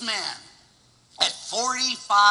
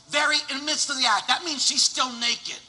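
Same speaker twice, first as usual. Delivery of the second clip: ordinary talk, nothing marked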